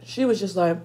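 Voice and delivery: ordinary talk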